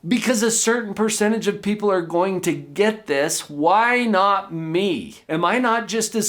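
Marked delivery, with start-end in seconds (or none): 3.5-5.2 slow, deliberate. Real, not acted. Repeated reflective question.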